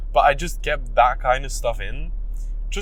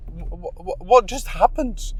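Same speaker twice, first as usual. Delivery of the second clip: usual speaking voice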